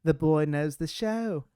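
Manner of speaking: loud, slow British proclamation; drawn-out theatrical British imitation; British accent. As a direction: announce it grandly as a British stage manager. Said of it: "killing it on that British accent".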